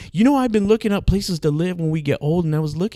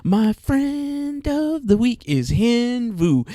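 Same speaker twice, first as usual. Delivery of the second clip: slow, high, drawn-out announcement; announcer voice; 8 semitones higher, much slower than usual. Acted. Host introducing friend of the week, slow grand announcer. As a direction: present it like a grand award.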